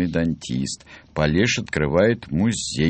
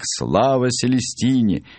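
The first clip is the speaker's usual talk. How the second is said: a rousing hail, pitched up; crowd's cry; 7 semitones higher than usual. A narrator's reading. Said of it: "стал крик".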